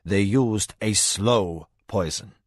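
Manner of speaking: the spymaster speaking gently to a frantic husband, slow and low. A narrator's reading. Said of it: "More gently".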